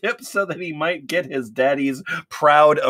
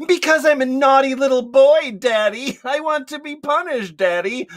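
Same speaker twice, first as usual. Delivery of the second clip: mock whine; 10 semitones higher than usual. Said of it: "Ben Shapiro whined".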